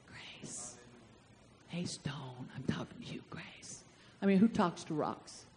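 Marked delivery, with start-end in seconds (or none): 0.1-3.8 barely audible, low, sheepish whisper; furtive whisper. Acted. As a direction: whisper it so nobody notices you. Acts out someone hoping nobody hears.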